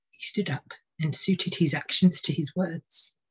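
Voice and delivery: usual speaking voice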